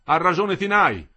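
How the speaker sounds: high, loud protest; rally the crowd against the bosses; loud, insistent striker's harangue; heated agitation. A narrator's reading.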